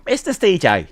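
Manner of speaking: high-pitched nickname catchphrase; impression of Mister C J. Streamer imitates the catchphrase, with a bit of high pitch. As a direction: call the name out with a high lift. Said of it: "That's a good impression".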